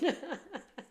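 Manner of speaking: a bright burst of high laughter; high giggle. Real, not acted. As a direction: let a laugh escape. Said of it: "I'm laughing".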